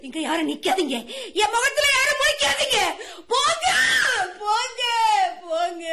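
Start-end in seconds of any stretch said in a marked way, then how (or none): 1.4-5.9 crying shout. Acted. Widow to her relatives, shouting through tears to leave. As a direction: drive them out, wailing.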